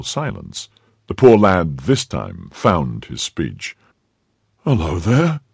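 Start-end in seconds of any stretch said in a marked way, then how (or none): 4.6-5.4 higher, indignant call; crying out. A narrator's reading. Woken servant challenges a noisy intruder, crying out. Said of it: "cried he".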